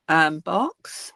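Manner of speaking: ordinary talk, nothing marked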